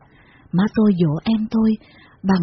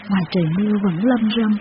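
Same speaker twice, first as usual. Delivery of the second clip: ordinary delivery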